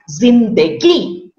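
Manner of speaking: zinda-GI, slow, weight on GI; final-syllable stress. Shown as a demonstration. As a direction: drag it out and land on GI in "zindagi". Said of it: "stress on the third part".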